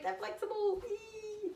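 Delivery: high, bouncy squeal of delight; playful squeal. Real, not acted. Showing viewers her bendy camp shoes, a high playful squeal. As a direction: squeal happily while flexing the shoes.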